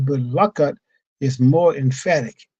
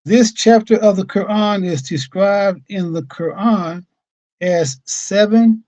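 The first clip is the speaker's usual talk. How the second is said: louder, higher, measured reading voice; formal reading aloud; 5 semitones higher, 6 dB louder than usual. Acted. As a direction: proclaim the teacher's written words deliberately. Reads the Imam's words aloud.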